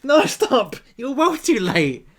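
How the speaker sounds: loud, high laughing protest, slow at the end. Real, not acted. Outtake, telling the helper the cue was late: laughing loud protest. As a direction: yell stop through laughter.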